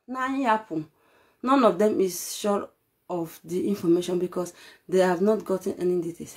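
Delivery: ordinary delivery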